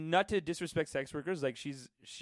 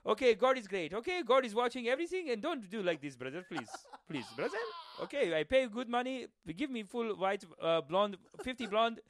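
put-on higher-pitched Arabic-accented weapons buyer; mock Arabic accent; 5 semitones higher than usual. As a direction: place the order in a hammed-up Arabic accent. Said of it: "a guy who's ordering a bunch of weapons".

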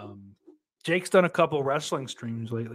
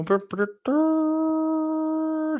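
trilled brr; much slower than usual. Pretend. Playful noise while waiting.

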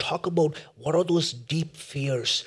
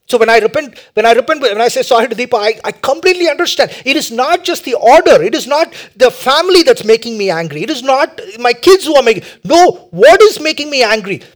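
loud, high, urgent preacher delivery; full-force preaching; 9 semitones higher, 15 dB louder than usual. Real, not acted. Preacher urging listeners to identify their idol, full-force preaching. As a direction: drive each point home at high volume.

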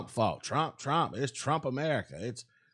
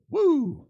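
a high, loud laughing whoop; excited whoop; 12 semitones higher, 6 dB louder than usual. Real, not acted. To viewers, fixing the contract figure with a loud whoop. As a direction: let out a gleeful woo.

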